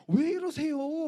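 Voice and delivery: alarmed protest. Retold or imitated. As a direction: girlfriend shrieking at the bully. Girlfriend protests the slap. (놀라서 크게 항의하는 여자친구 목소리)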